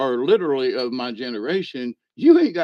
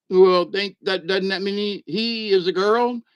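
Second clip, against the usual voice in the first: ordinary talk, nothing marked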